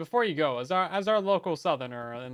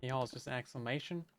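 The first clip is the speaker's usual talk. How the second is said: normal talking voice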